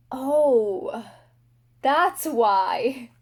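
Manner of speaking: ordinary talk, nothing marked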